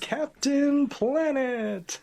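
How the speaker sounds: high, loud, drawn-out hero proclamation; mock-heroic summoning cry; Captain Planet. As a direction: announce yourself like a cartoon superhero. Imitating the cartoon hero's summoning.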